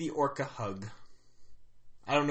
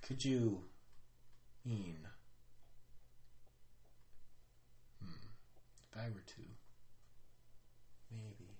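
very quiet, halting mumble to oneself; muttering; 6 semitones lower, 16 dB quieter than usual. Acted. Player's character muttering in a corner, thinking to themself. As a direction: mutter low with long pauses. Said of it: "I mutter".

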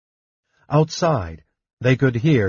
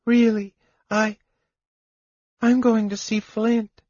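high, light kender voice, fading; a brave little kender saying goodbye; high-voiced kender, calm and dying; 11 semitones higher than usual. A narrator's reading. Dying kender's last words.

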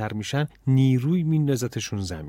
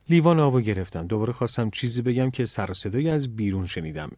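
ordinary delivery, nothing marked

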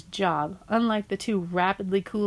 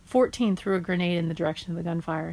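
ordinary delivery

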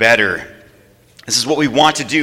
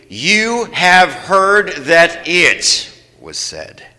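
very slow, each word drawn out; slow reading together; 3 semitones higher, much slower than usual. Shown as a demonstration. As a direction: lead them through word by word. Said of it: "read those first five words with me".

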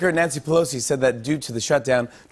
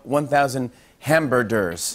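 slow deliberate read; slower than usual. Shown as a demonstration. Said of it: "sending a tweet that said".

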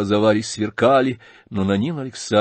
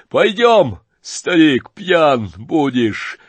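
loud jeering; 6 semitones higher, 6 dB louder, much slower than usual. Acted. Rogozhin jeering at the old man.

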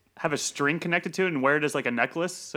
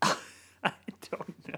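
whisper; 5 dB quieter, much slower than usual. Pretend. Echoing the alien whisper.

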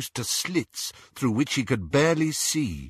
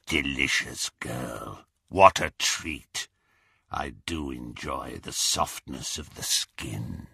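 rasping; 7 semitones lower, 4 dB quieter than usual. A narrator's reading. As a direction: savour it in a low rasp. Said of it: "rasping voice".

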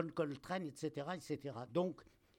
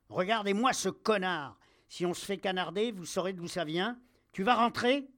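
loud, higher-pitched angry tirade; furious captain; 5 semitones higher, 5 dB louder than usual. Acted. Officer turns to troops, revolver on soldier, furious. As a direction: rail at him before the men.

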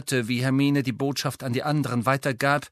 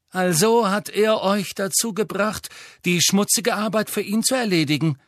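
Harry voice; 6 semitones higher than usual. Acted. Harry accuses the Death Eaters.

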